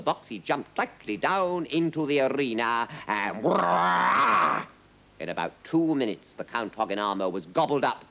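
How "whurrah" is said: loud, rolling mock roar; lions' roar. A narrator's reading. Narrator imitates lions seizing the count, roaring. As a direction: roar as the beasts pounce.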